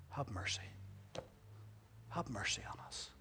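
whispered, low plea; humble plea. Shown as a demonstration. Lepers daring only to ask humbly, whispered. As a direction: breathe it humbly. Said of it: "That's all we're asking".

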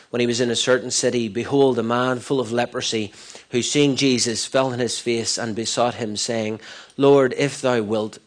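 loud, fast, declaiming Bible reading; loud scripture reading. Acted. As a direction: proclaim the verses to the congregation. Reading scripture aloud at full force.

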